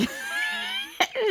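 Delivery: a high burst of real laughter; high squealing laugh. Real, not acted. Mother laughing at her daughter's reply, high squeal.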